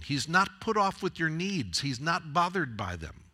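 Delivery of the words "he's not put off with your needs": ordinary talk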